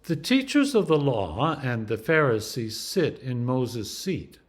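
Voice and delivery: ordinary delivery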